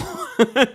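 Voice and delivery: real laugh, high and loud. Real, not acted. Host cracks up after mimicking Simon, a loud high laugh. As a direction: break up laughing at yourself.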